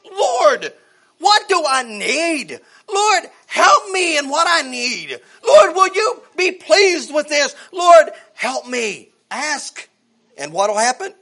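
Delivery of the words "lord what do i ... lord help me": slow, louder pleading to the Lord; preacher acting out a believer praying for help; acted prayer. Voices a prayer as example.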